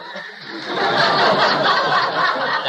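high burst of real laughter. Real, not acted. Lecturer laughing at the heavy next birth, high laugh. Laughs at his own joke.

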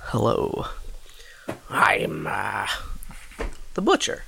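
butcher voice. Pretend. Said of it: "Is that your Butcher voice?".

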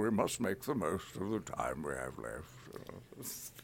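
put-on slow, soft old wizard; unhurried wise mentor; gentle Gandalf. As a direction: counsel a young friend quietly. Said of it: "the voice of Gandalf".

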